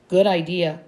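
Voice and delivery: ordinary delivery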